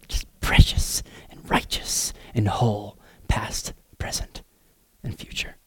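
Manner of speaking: slow, soft whisper, fading away; tender whisper. Acted. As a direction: breathe it like a secret blessing. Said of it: "And he says".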